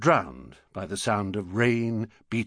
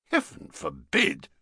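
exclamation; 9 semitones higher than usual. Acted. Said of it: "exclaimed Mister Brown".

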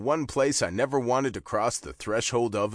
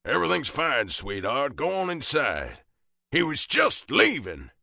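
put-on higher southern-accented reassurance; southern father reassuring; 4 semitones higher than usual. A narrator's reading. Southern father calling to his wife, reassuring.

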